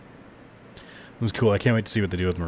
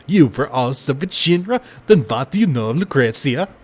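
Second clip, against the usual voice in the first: Vincent voice; 6 semitones higher, 5 dB louder than usual. Acted. Streamer voicing Vincent from his coffin, louder brooding delivery. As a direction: intone it darkly like a cursed gunman. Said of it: "the accent seems spot on".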